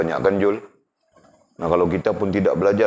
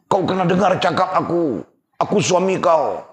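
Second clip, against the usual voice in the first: high, loud, angry husband shouting; a husband laying down the law to his wife; 12 semitones higher, 4 dB louder than usual. Acted. (suami marah, suara tinggi)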